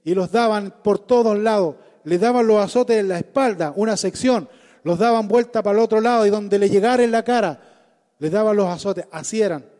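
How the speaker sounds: impassioned loud sermon delivery; preaching at full force. Acted. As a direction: preach it hard and fervent. (predicación apasionada a toda voz)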